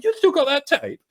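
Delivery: very high, loud query; acted-out sudden interest; eager question. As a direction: ask with pitch leaping up. Excited question about the tape.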